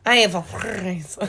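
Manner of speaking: slow fake French with a purred R in "France"; purring French accent. Shown as a demonstration. Trying to imitate French for a co-host, purring French accent. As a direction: roll "France" like a cat purring, mock French. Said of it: "Sounds like a cat purring".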